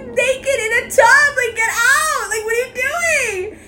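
high, loud laughing protest; mock outraged yell. Retelling the door moment, a high laughing yell. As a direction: shout him out of the bathroom.

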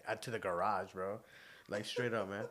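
ordinary delivery

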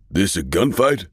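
loud taunting yell across the hall; a cocky colonel's shouted taunt. A narrator's reading. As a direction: call out the challenge. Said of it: "the colonel yelled".

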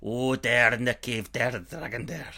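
a hint of an old highlander; low Scottish storyteller. Telling his friend of the dragon in a low Scottish voice. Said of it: "It went Scottish".

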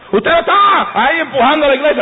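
high mock voice of a critic. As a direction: play a scoffing churchgoer pointing fingers.

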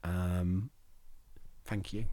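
crying, quiet thanks through tears; choked-up soft thank you; blubbering. Real, not acted. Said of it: "I'm sorry I'm crying".

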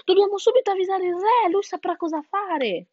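high, urgent, alarmed hen; frightened hen. A narrator's reading. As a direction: exclaim in a fright, rushing to act. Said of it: "Penelope era tutta spaventata". (gallina spaventata, voce alta e concitata)

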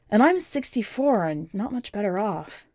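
usual speaking voice

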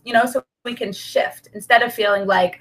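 ordinary talk

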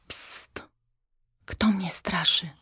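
hushed hiss of a startled horse; stage whisper. A narrator's reading. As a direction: whisper it sharply, close and secret. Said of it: "syknął koń".

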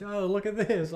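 high, loud excited mock voice. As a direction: squeal it like an eager kid. Mocking friend showing videos.

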